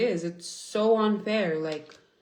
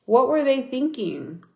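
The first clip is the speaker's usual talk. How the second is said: outraged repeat; 6 semitones higher, 6 dB louder than usual. Retold or imitated. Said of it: "I was like".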